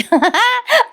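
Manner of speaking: high, bursting laugh of disbelief; helpless giggle at the show; real amused laugh. Real, not acted. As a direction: crack up at how silly it is. Narrator laughs at absurd scene.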